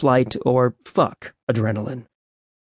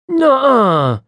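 high, loud disbelieving nuh-uh; shocked denial, pitched up; protesting; 12 semitones higher, 5 dB louder than usual. A narrator's reading. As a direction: protest in wide-eyed disbelief. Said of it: "Demetrius protested".

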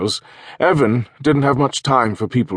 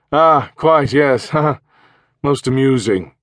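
throaty posh English major, raised and loud; upper-class English; 6 semitones higher, 5 dB louder, slower than usual. Acted. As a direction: play a pompous retired English officer. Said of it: "in a throaty, upper-class English voice".

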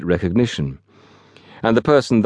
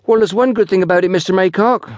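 put-on high, quick young porter; 12 semitones higher, 3 dB louder than usual. A narrator's reading. Young porter offers his boss some comfort, eager. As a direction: pitch it high and rustic, like an eager lad. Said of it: "said the younger of the two porters".

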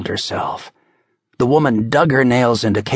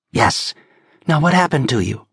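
fast, a touch raised questioning; brisk cop; 4 semitones higher than usual. Acted. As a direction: press her for answers. Casey questioning quickly.

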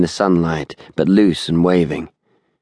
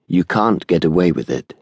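ordinary delivery, nothing marked

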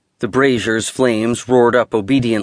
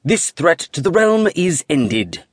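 a higher, formal voice declaring the threat is over; announced; 5 semitones higher than usual. A narrator's reading. Wizard to his two fellow wizards, announcing the end of the threat. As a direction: proclaim it like an official verdict. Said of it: "and announced".

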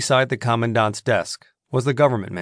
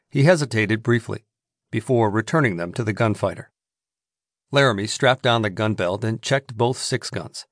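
usual speaking voice

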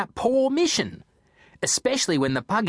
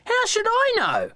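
high, offhand small-dog voice; Foxy the dog. Acted. Foxy the dog brushing off Jack, high and offhand. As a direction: shrug it off.

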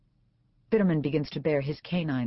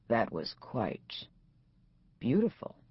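maybe sarcastic; 3 semitones lower, slower than usual. A narrator's reading. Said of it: "if he's being sarcastic".

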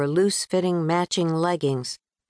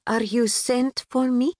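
put-on a touch of Pakistani accent; 6 semitones higher than usual. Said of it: "a bit sing-song, infused with a British twang".

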